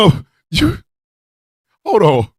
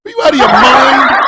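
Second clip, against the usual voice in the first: laughing disbelief; 5 semitones higher, 5 dB louder than usual. Real, not acted. Incredulous laughing outburst.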